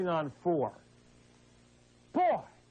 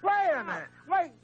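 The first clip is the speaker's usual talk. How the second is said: high-pitched exasperated outburst; offended host talking over a caller; indignant interruption; 7 semitones higher than usual. Real, not acted. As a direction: cut in, pitch jumping up in indignation. Host indignantly cuts off reciting caller.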